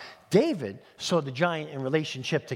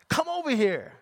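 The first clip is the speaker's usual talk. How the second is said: mock giant's yell; 7 semitones higher than usual. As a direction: summon your foe like a sneering giant. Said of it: "Goliath yelled".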